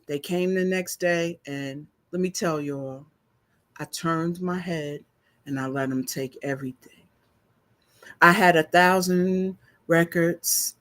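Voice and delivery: usual speaking voice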